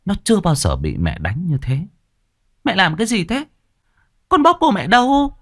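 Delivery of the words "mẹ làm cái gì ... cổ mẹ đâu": high, protesting daughter voice; a young girl's high wounded protest; shocked young daughter. A narrator's reading. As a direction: cry out in hurt disbelief. Daughter protests after being slapped.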